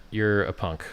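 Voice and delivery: ordinary talk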